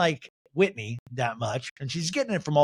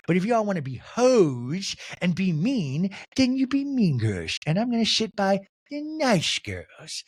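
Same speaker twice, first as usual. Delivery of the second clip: slow, high mock huff; Whitney impression; 5 semitones higher than usual. Castmate giving up on helping mean girls, slow mock huffiness. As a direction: draw out the key words, pitched up. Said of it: "And Whitney goes".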